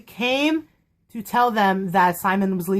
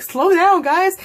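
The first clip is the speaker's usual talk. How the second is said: mock call to friends ahead, higher and louder; 9 semitones higher, 5 dB louder, slower than usual. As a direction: imitate someone begging the group to wait. Retold plea while struggling to keep up.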